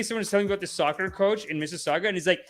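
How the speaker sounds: ordinary delivery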